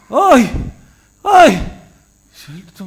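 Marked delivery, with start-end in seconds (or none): 0.1-1.5 loud cry of alarm; shout. Retold or imitated. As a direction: shout out in horror, high and loud. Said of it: "אבא נתן כזה צעקה". (צעקת בהלה חזקה)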